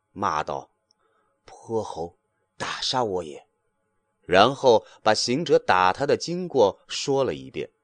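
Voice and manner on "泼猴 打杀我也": weak groan. A narrator's reading. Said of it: "呻吟一会儿".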